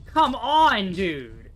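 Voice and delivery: loud exasperated come on; frustrated yell. Real, not acted. Frustrated mid-fight.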